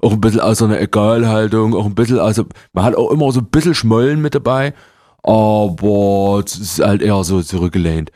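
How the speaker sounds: gutturales, gemütliches Dresdner Sächsisch; zurückgelehntes Sächsisch mit etwas Schmollen. Shown as a demonstration. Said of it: "das ist guttural, das kommt einfach ganz tief". (Dresdner Sächsisch, tief aus der Gemütlichkeit)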